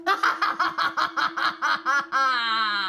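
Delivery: loud, high maniacal cackle; long villainous laugh in rapid, even bursts. Acted. Demon heard in a dream, maniacal laugh. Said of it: "this maniacal laugh".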